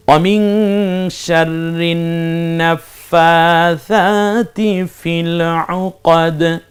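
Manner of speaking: slow, drawn-out chanted recitation; Quranic recitation. Shown as a demonstration. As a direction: intone each word slowly like tajweed reading. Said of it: "teacher recites Quran verse".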